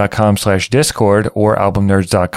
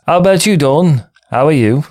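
bad British accent; 6 semitones higher, 3 dB louder than usual. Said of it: "Yeah, that sucked".

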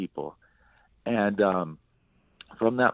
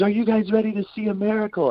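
retold crowd-rousing shout, high and loud; high, loud hype-man call; Caleb screaming; 11 semitones higher, 6 dB louder than usual. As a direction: imitate a preacher whipping up a crowd. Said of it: "he's screaming".

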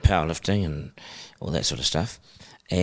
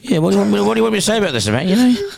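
higher and louder, mock flustered; flustered reply; 11 semitones higher, 5 dB louder than usual. Host answering the inmate about his wife, mock flustered. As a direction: stumble through it caught out. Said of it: "I'm like".

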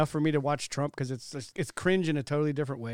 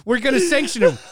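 laughing mock threat; 6 semitones higher, 7 dB louder than usual. Mocking politicians' empty threats.

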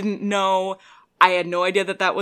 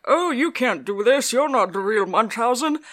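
lightly imitated character accent, pitched up a touch; Jonathan Pryce; 4 semitones higher than usual. Quoting his objection to Munchausen, lightly put-on accent. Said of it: "he has that amazing accent".

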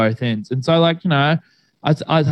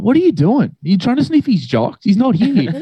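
raised, high, incredulous scolding; retold exasperation at mum; 6 semitones higher than usual. Son catching mum in his brother's room, exasperated scolding. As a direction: tell her off, you can't believe it.

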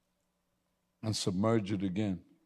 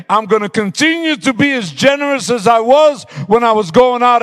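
high, loud, driving sermon climax; full-force preaching; 10 semitones higher, 13 dB louder than usual. Acted. Pastor shouting his vow to God to the church, high and loud.

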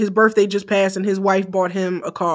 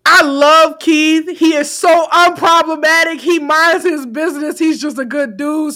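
gushing; 7 semitones higher, 8 dB louder than usual. Real, not acted. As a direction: gush about him, pitched up. Gushing about Keith Lee.

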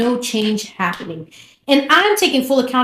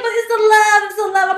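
much higher, whiny pleading; mimicking a lovesick friend; 11 semitones higher, 5 dB louder than usual. Friend insisting it's love, in a high whiny mock voice. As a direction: plead it like a starry-eyed girl. Voicing friend defending her man.

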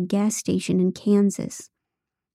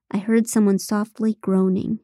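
plain reading voice

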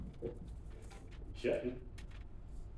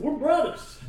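much higher, louder joyful cry; excited; 8 dB louder, slower than usual. Retold or imitated. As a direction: burst out with delight. Excited claim of kinship.

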